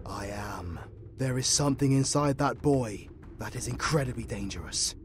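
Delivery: low, soft seriousness; hushed, ominous certainty; grave warning. Acted. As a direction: warn them in a low voice. Grave warning about the boy.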